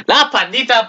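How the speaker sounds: high, bright cry of delight at the panda; excited cheer. Real, not acted. Vlogger cheering the panda's return to viewers, excited. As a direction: greet the returning panda with a happy yelp.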